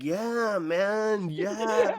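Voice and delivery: a hint of a mellow stoner clerk; stoner dude. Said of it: "like, stoner dude".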